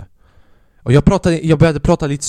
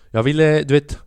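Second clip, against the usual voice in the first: ordinary talk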